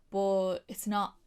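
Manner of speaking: usual speaking voice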